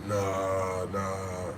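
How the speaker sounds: low, quiet drawn-out mumble; reluctant refusal. Retold or imitated. Others declining to battle uptown, low reluctant refusal. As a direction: imitate them backing out, low and soft.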